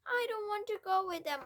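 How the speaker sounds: high, loud defiant shout. A narrator's reading. Matilda refusing to leave with parents, loud shout. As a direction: refuse outright. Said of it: "Matilda shouted suddenly".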